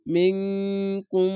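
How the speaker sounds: slow মিংকুম, nasal ng and final m both held; ikhfa ghunna. Shown as a demonstration. Teacher demonstrating ikhfa ghunna, মিংকুম with long nasal holds. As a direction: stretch মিংকুম on a hummed ng and m. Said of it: "এটি ইকফা গুন্না".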